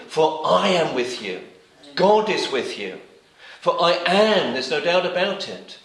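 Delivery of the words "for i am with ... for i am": I AM pressed hard and louder; emphasize each thing. Shown as a demonstration. Preacher urging his friends, louder stress on I AM. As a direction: lean on I in "For I am with you". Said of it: "Emphasize each thing".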